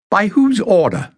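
sharp, high, loud demand. A narrator's reading. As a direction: snap the question back at him. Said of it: "Jasmine demanded".

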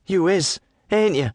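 put-on high, needling young woman voice; teasing lass. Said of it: "she says".

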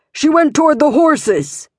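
high, urgent shout from a distance; pursuer shouting. A narrator's reading. Pursuer calling to the search party, urgent shout. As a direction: yell out the alarm to the other hunters. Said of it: "she heard the siren of death".

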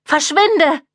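„Verschwinde!“ high, cracking with fear; mother drives him off in panic; fear-distorted. A narrator's reading. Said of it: "Ihre Stimme klang vor Angst verzerrt". („Verschwinde!“ hoch und angstverzerrt)

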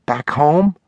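put-on high little-girl question, rising at the end; child's high, hopeful query. A narrator's reading. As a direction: ask it as a small child, pitched high. Little daughter asks her father.

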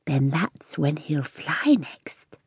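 slow, hushed, secretive whisper; a wise old cat whispering to the girl. A narrator's reading. Cat Lilyleaf's voice.

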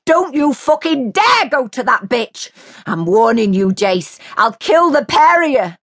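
high, loud furious scream. A narrator's reading. Mary screaming at Jace as he leaves. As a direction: scream it after him down the hall.

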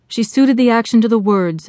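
ordinary delivery

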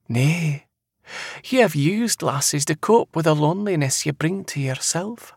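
high Scottish seer voice; knowing seer. Acted. Seer correcting the man about lasses, high and knowing. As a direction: tell him high and assuredly.

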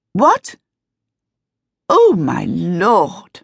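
sudden shocked realisation; surprised. Acted. Sees the coach arrive.

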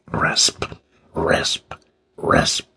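hushed, spaced-out "rasp"; whispered rasping noise. A narrator's reading. Eerie sound King hears in the dark, whispered rasping. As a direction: whisper it like a saw stroke. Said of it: "yet rasping".

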